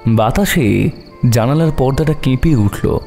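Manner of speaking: plain reading voice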